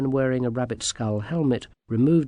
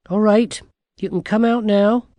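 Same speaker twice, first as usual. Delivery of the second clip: put-on higher, louder girl's call; 9 semitones higher, 9 dB louder than usual. A narrator's reading. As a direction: call the hidden ones out. Calling out hidden little men.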